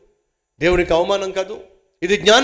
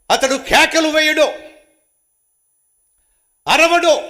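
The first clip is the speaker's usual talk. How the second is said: emphatic; 8 semitones higher, 5 dB louder than usual. Acted. Reading Isaiah with emphasis.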